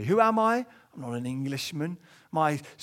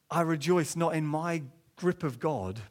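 ordinary delivery

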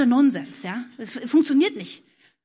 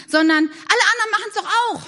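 mock excuse; 6 semitones higher, 4 dB louder than usual. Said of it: "Man kann nämlich auch sagen".

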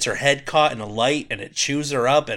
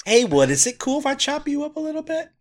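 polite lumberjack voice; 14 semitones higher than usual. Pretend. Asking the wood's permission to chop.

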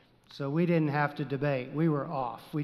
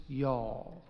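"y'all" softer, lower, Texas drawl; Southern y'all; 5 semitones lower, 5 dB quieter than usual. Shown as a demonstration. Preacher to his congregation, naming the Southern plural in a softer drawl. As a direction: stretch "y'all" slowly, Southern style.

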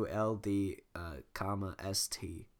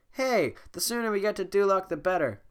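chirpy donkey voice; 8 semitones higher, 6 dB louder than usual. Acted. Voicing Donkey.

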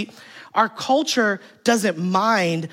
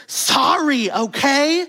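higher, snapped sorry; snippy sorry; 6 semitones higher, much slower than usual. Retold or imitated. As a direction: throw it back with attitude. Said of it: "say sorry nicely".

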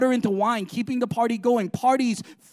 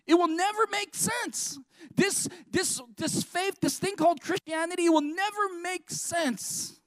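preaching emphasis; 7 semitones higher, 4 dB quieter than usual. Acted. Preacher urging the church that faith needs living. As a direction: press it home with intensity. Sermon climax, held emphatic.

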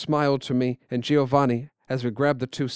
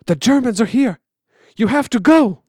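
loud and higher, urgent alarm; urgent warning; 9 semitones higher, 7 dB louder than usual. Acted. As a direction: warn them the Germans have come. Old man warns of Germans.

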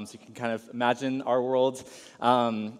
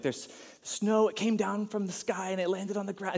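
lightly voiced excited small boy, higher; a hint of excited child; 7 semitones higher, 3 dB quieter than usual. Boy describes the snowfall to his dad, lightly voiced excited child. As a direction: explain it like a wide-eyed kid. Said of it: "he's, like, explaining the whole detail".

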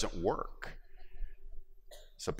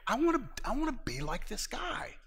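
awed wish. Retold or imitated. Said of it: "I'm like".

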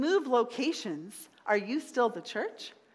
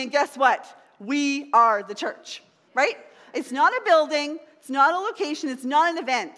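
loud, higher, fervent preaching; preaching at full force; 4 semitones higher, 5 dB louder than usual. Acted. Pastor rallying his congregation, loud fervent preaching. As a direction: drive it home to the congregation.

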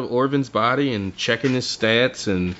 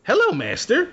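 high small creature voice; familiar's voice; 12 semitones higher than usual. Retold or imitated. Narrator voices the familiar greeting her master, high and small. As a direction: pitch it way up, sweet and new. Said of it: "and she goes".

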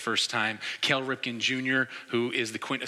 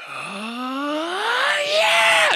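long rising wail bursting into a very high, loud yell; whooping mock victory scream; screaming celebration; 8 dB louder than usual. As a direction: imitate a fan erupting in victory. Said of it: "he just starts screaming".

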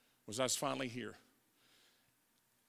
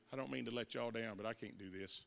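much softer, lower and faster, fading away; ashamed confession; 6 semitones lower, 14 dB quieter than usual. Retold or imitated. Son telling his dad he's quitting rehab, softly ashamed. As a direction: mumble it out in shame.

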